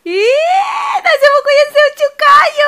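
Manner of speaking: high, loud excited squeal. Acted. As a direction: squeal it for the baby. Excited baby talk.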